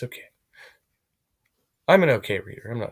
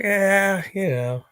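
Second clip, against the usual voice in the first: loud, higher-pitched mock voice; hammed-up impression of an unimpressed guy; mimicking someone's reply; 10 semitones higher, 7 dB louder, slower than usual. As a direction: put on his reply, raised and exaggerated. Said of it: "well, he's like".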